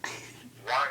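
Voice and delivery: usual speaking voice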